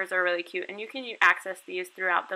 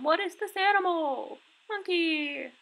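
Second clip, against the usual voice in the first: put-on much higher, sing-song kid-teacher question; bright teacher voice; 10 semitones higher than usual. Shown as a demonstration. Online teacher quizzing young student, much higher. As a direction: pitch it way up for little kids.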